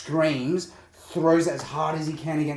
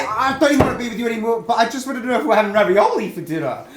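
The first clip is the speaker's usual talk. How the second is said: mock breakup line; 9 semitones higher, 8 dB louder, faster than usual. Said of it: "Adam, you talk".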